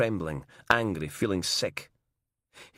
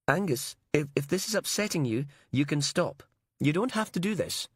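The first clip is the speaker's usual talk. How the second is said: gentle nurse; 7 semitones higher than usual. Acted. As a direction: coax him to stop, kindly and high. Nurse comforts Robertson.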